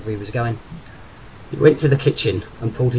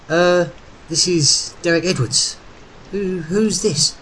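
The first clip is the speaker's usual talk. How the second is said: slow, higher-pitched, faltering reply; hesitant, flustered; 6 semitones higher, slower than usual. A narrator's reading. Dumbstruck Derek answering the phone voice, flustered and halting. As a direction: stumble through it, caught off guard. Dumbstruck man stammers his name.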